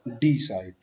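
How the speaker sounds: Decide with a long DEE at the front; wrong stress. Shown as a demonstration. Teacher repeating the mistake, decide stressed on first syllable. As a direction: stretch डि, let side fall. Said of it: "आपने नहीं बोलना".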